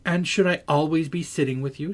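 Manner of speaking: high, irritated challenge; irritated demand. A narrator's reading. Catherine pushes back at Heathcliff's claim on her, irritated. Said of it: "growing more irritated".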